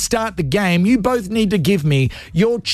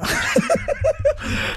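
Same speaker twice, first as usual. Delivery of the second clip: high giggle. Real, not acted. Laughs at own ramble.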